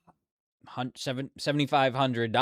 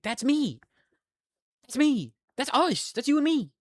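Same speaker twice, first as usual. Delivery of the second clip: much higher, playful chirp; giddy high voice; 13 semitones higher, 4 dB louder than usual. Real, not acted. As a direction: squeal it back happily. Gleeful replies to chat.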